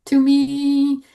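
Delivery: normal talking voice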